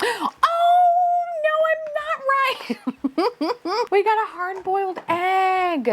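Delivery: high, slow squeal with laughter; excited squeal. Real, not acted. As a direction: shriek it out laughing. Surprise reveal while unboxing.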